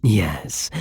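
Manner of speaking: ordinary talk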